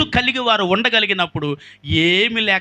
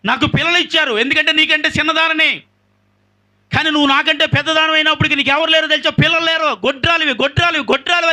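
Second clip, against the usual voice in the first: put-on high, loud sneering taunt at a childless rival; cruel taunting; 7 semitones higher, 3 dB louder than usual. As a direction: jeer at her, rising with each repeat. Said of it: "భయంకరంగా ఆ మాటలు అంటుంటే". (ఎత్తైన, బిగ్గరైన ఎగతాళి స్వరం)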